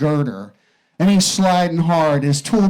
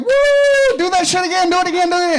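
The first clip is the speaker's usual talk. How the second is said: whooping wife; 12 semitones higher than usual. Acted. Wife egging her husband on, high whooping cheer. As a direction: cheer him on like it's a party. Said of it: "And she's like".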